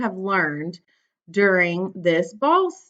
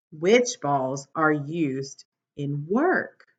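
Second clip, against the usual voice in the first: bright, lively storybook read; reading to children; 3 semitones lower, slower than usual. Acted. Teacher reading a picture book to her class, bright and lively.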